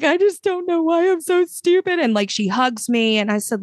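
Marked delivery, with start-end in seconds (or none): 0.0-2.0 put-on tearful girl. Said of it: "she just starts crying".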